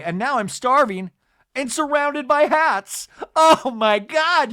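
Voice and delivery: hammed-up reality-star outburst; exaggerated excited wailing. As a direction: build into an over-the-top high cry. Acted starving complaint from Heather.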